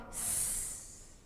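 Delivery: long S hiss fading to nothing; "S" held long, tapering away. Shown as a demonstration. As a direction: draw out "S" as a hiss that disappears. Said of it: "are they hissing".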